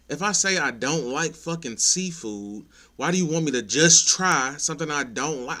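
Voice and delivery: venting hard about being pushed to eat; exasperated rant. Real, not acted. Ranting to viewers about pushy date, loud exasperated.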